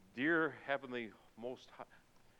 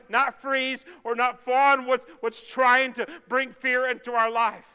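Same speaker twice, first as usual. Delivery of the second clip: louder, pressing preacher's call; rising sermon fervor; 7 semitones higher, 13 dB louder than usual. Real, not acted. Pastor urging his church not to flee, fervent preaching. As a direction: drive the point home to the church.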